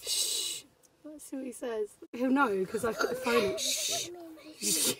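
trying hard not to laugh; stifled giggles. Real, not acted. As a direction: giggles held back under shushing. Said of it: "Try not to laugh".

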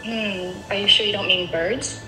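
ordinary talk

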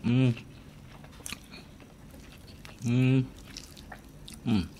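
humming while chewing; closed-mouth murmur with food; mouth full, chewing. Real, not acted. Seen 2: Mouth closed and moving after putting food in at -4s.

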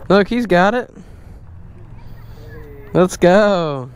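usual speaking voice

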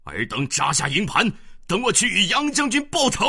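high, loud wrathful command; furious order. A narrator's reading. General ordering troops, vowing revenge in fury. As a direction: bark the order in rage. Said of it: "大怒道".

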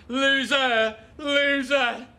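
bitter shout. Acted. Man yelling at himself in bitter anger. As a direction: spit the word out.

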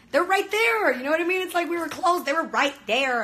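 high, loud excited gush; excited fangirling. Real, not acted. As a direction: relive the thrill of seeing them. Recalling BTS on stage.

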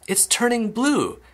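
high, drawn-out cry of alarm; mock scream. A narrator's reading. Mother seeing Violet's nose change, mock screaming. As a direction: stretch it out high, in horror. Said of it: "screamed Missus Beauregard".

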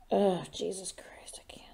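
breathless whispered gasp of awe; overwhelmed fangirl whisper; awed whisper. Real, not acted. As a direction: whisper it like it's too much to bear. Overwhelmed fan reaction.

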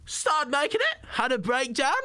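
high, slow mimicry of the comedian's voice; James Acaster impression. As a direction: imitate the comedian on stage. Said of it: "That's a really weirdly good impression".

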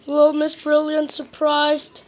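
ordinary delivery, nothing marked

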